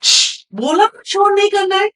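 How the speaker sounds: sharp shush, then high-pitched scolding; stern scolding. Acted. Nurse silencing a noisy patient, scolding sharply. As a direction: hush them crossly, pitch jumping up.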